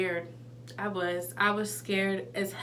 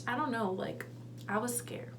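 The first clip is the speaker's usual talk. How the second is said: much softer, subdued; quiet admission; 11 dB quieter than usual. Real, not acted. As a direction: drop the voice and admit the fear. Quiet confession of fear.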